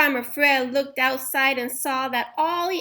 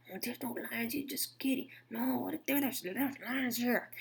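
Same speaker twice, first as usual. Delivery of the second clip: much softer hushed argument; whispering; 11 dB quieter than usual. A narrator's reading. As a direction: murmur back and forth. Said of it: "whispered to one another".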